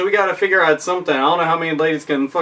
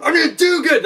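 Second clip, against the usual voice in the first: much higher, hyped-up vow; overeager; 11 semitones higher than usual. Pretend. Overeager player about to piss everywhere, much higher hyped vow. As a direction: sound way too into it. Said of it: "and it's like".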